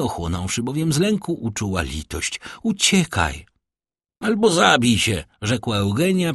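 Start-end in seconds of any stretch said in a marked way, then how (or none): 2.6-3.5 higher-pitched plea to flee; lightly acted call; urging cry. A narrator's reading. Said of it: "zawołała panna d'Armilly". 4.2-5.2 higher, imperious verdict; lightly acted lofty command; vestal's lofty tone. A narrator's reading. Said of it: "przybrawszy ton i pozę westalki".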